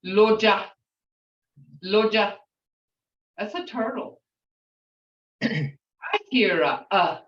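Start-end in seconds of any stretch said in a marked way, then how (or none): 0.0-2.3 Loja louder, with a long o; Muskogee word. Shown as a demonstration. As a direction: say Loja firmly with an open long o. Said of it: "how people pronounce it".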